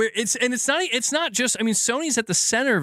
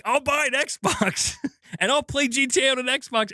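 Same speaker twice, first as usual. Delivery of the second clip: higher-pitched mock threat with a laugh; mocking rant; 7 semitones higher than usual. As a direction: laugh through a silly, high boast. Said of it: "that's why I'm literally like".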